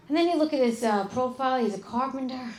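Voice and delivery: ordinary delivery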